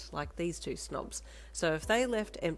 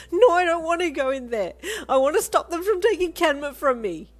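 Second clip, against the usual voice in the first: high, loud tearful protest; loud crying pleas; sobbing protest; 12 semitones higher, 14 dB louder than usual. Acted. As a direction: fight out of her grip while crying. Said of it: "you sobbed".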